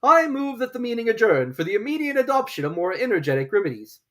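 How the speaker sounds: high, pompous formal motion; solemn Dodo. Acted. Dodo addressing the meeting, high and pompous. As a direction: propose it with great ceremony.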